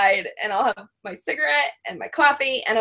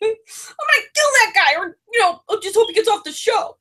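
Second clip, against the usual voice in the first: outraged rant; 14 semitones higher than usual. Retold or imitated. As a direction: blow up about the judge. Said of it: "And he's like".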